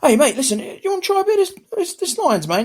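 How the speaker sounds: mock sales pitch to a mate. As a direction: parody an eager mate pushing a drink. Mocking S0 offering lion's mane.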